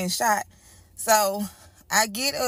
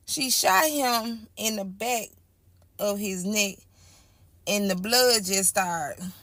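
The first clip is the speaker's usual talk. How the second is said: usual speaking voice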